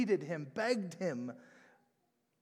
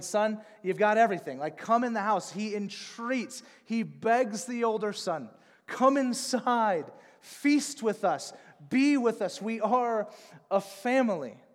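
begging son to come inside; earnest fatherly entreaty; pleading father; 5 semitones higher, 7 dB louder than usual. Acted.

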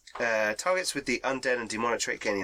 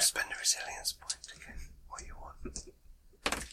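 soft, hushed aside; whispering; 9 dB quieter than usual. Real, not acted. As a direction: whisper it like a secret tip. GM aside during tense roll.

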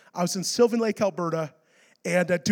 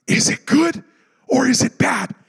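raised, loud re-enacted questioning; anxious question; 4 semitones higher, 6 dB louder than usual. Preacher replaying his question to the pastor, anxious and loud. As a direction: press the pastor urgently for an answer.

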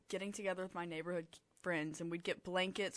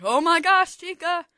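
alarmed cry; 11 semitones higher, 18 dB louder than usual. Retold or imitated. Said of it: "I was like".

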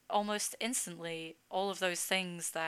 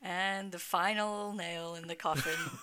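mock dramatic; 5 dB louder than usual.

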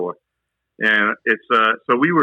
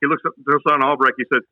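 ordinary delivery

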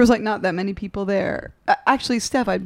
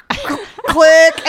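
mock click; 7 semitones higher, 8 dB louder than usual. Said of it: "so I was like".

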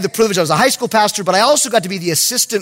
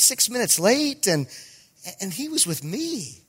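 soft, halting, placating voice; tentative appeal; 6 dB quieter than usual. Retold or imitated. Youth pastor to the angry head coach, soft tentative appeal. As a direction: plead gently with pauses and hesitations.

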